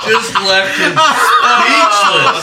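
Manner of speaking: laughing. Real, not acted. Laughing at the nurse story.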